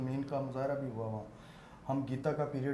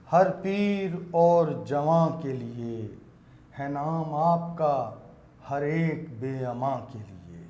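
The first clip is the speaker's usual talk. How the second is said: louder, slow poetic recital; naat recitation; 4 dB louder than usual. Acted. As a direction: declaim it with reverence. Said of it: "نعت کے چند شعر پیش کرنا چاہوں گا".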